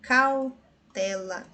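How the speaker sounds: cautela drawn out slowly; slowed cautela for students; slow syllable demo. Shown as a demonstration. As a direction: sound out cautela. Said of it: "Nas outras, nós temos".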